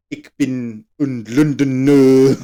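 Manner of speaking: badly faked German accent, drawn out; botched German accent. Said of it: "Just German with French accents".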